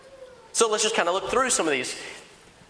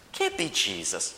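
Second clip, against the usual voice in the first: low, softer dismissal; skeptical; 10 semitones lower, 7 dB quieter than usual. Acted. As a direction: doubt it under your voice. Acted doubter answering his friend.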